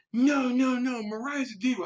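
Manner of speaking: very high, protesting mimicry; mock fussy assistant. Assistant objecting to crew leaving the rooms, mock fussy protest.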